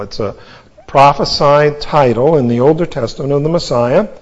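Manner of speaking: usual speaking voice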